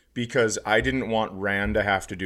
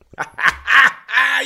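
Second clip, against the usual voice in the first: high shrieking laugh of glee; wild triumphant laugh; 9 dB louder than usual. Acted. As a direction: cackle like a gleeful madman. Triumphant cackle after the show.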